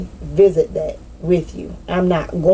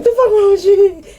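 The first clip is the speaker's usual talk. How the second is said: high, loud squeal, laughing through it; mock outraged squeal; 6 dB louder than usual. Mocking someone who got cut off, high laughing squeal. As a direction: laugh as you squeal the indignant question. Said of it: "You're like".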